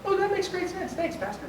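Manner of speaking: high, softening mock agreement; mock congregation. Pastor mimics congregation nodding along, high and soft. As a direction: play the easily satisfied churchgoer. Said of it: "Then everybody goes".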